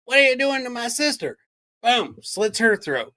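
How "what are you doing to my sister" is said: put-on high-pitched small child's protest; little kid confronting a grown-up; mock two-year-old. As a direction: voice a toddler challenging someone, pitched well up. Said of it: "The two-year-old comes running".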